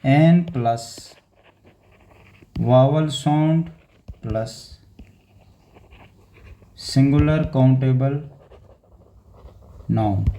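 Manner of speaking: slow, each word set apart with pauses; slow spaced-out repeat. Shown as a demonstration. Teacher repeating the rule to students, slow and spaced. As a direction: dictate the rule slowly, word by word. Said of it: "फिर एक बार बता देता हूँ".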